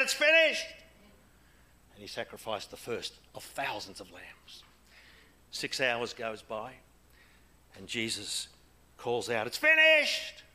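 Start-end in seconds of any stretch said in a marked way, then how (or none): 0.0-0.7 high, loud proclamation cried out; ringing call. Acted. High priest calls out in temple. 9.5-10.4 high, loud proclamation cried out; ringing call. Acted. Jesus calls out on the cross.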